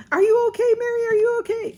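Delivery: high, loud mock call of concern; coworkers yelling. Coworkers rushing over, shouting at the fallen woman, high and loud. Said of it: "Everybody's yelling at me".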